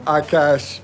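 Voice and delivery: ordinary talk, nothing marked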